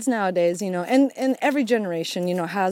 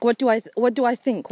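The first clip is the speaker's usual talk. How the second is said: ordinary delivery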